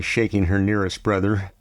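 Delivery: plain reading voice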